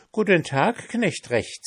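high, bright pancake greeting; sing-song cheeky pancake. A narrator's reading. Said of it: "sagte der Pfannkuchen".

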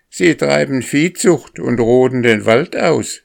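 high, loud crow's cry; shouting crow. A narrator's reading. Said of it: "schrie die Krähe".

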